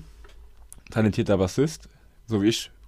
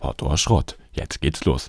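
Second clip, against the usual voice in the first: deep announcer; 8 semitones lower, slower than usual. Pretend. Repeats the title low.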